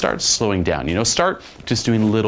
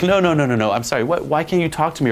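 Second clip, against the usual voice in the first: higher, hammed-up flustered protest; mock pleading; 6 semitones higher, faster than usual. Coach voicing a girl chasing him back, mock pleading. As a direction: imitate a girl begging him to stay.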